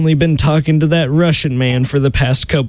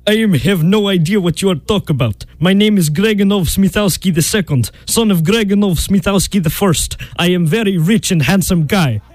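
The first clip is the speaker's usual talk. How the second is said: higher-pitched mock Russian accent; 3 semitones higher than usual. Disguised suitor insisting he is someone else, Russian accent. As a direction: boast in a put-on Russian accent. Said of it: "practiced his Russian accent".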